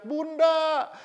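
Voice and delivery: high, loud mock call from afar; imitated gushing shout. Friend greeting women from far away, mock shout. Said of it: "udah teriak".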